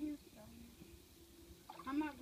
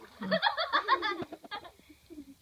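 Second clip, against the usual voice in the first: hearty burst of laughter; loud laugh; 4 semitones higher, 10 dB louder than usual. Real, not acted. Big laugh.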